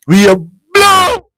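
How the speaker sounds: high, drawn-out taunting yell; mock shout. Russia man yelling one last taunt at the brother, high and stretched. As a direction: hurl it like a stadium taunt.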